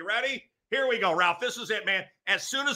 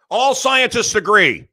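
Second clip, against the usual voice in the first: slow, loud, higher pundit proclamation; mock pundit impression; 4 semitones higher, 8 dB louder, slower than usual. Comic doing his impression, mock proclamation. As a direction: proclaim it like a TV authority. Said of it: "Here we go".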